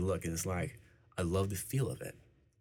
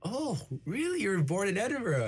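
much higher, excited Glasgow accent impression; 14 semitones higher, 3 dB louder than usual. Scottish homeroom teacher excited about his birthplace, Glasgow accent. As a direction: gasp it like a thrilled Glasgow teacher. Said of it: "in her Glasgow accent".

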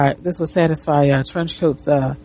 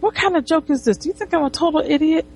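high, outraged-client imitation without shouting; mock indignant; 10 semitones higher, faster than usual. Client objecting to the three hundred charge, mock indignant. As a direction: imitate indignant protest, pitched way up.

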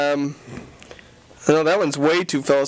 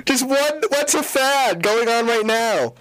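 slow exasperated; 5 semitones higher, slower than usual. Real, not acted.

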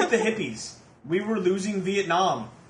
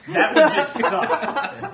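laughing; 9 semitones higher, 6 dB louder, much slower than usual. Real, not acted. Laughing at racing in oven.

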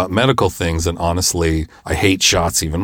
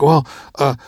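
high, faltering mock panic; panicking; 8 semitones higher, 3 dB louder than usual. Wife asking who drives to the hospital, panicking. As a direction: stammer it out, pitch climbing.

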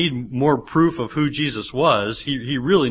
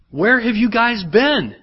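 higher and louder, slow mock exasperation; exasperated; 8 semitones higher, 4 dB louder, slower than usual. Pastor mocks the disciples' slow realization, exasperated and loud. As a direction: throw up your hands in mock disbelief.

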